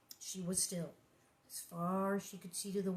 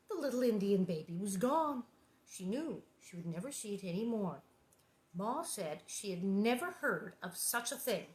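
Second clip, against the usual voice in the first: ordinary delivery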